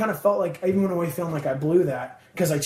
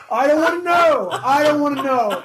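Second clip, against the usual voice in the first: louder, higher, laughing "I don't wanna know"; laughing refusal; 9 semitones higher, 7 dB louder than usual. Retold or imitated. Singer refusing to learn who threw it, laughing and loud. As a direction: wave it off through laughter.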